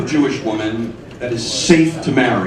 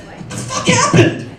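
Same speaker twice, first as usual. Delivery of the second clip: shocked outburst, much higher. Retold or imitated. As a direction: shriek it in disbelief, far up in pitch. Husband sees smashed car.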